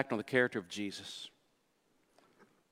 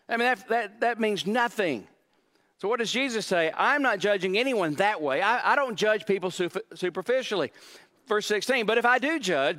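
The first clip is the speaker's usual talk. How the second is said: high, loud, driving sermon voice; a preacher at full volume; full-force preaching; 8 semitones higher, 5 dB louder than usual. Acted. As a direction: preach it out to the crowd.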